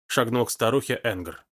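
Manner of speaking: plain reading voice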